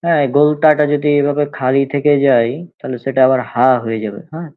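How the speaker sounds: measured recitation of Arabic letters, each said three times. Shown as a demonstration. Teacher drilling the alphabet for online students, clear recitation.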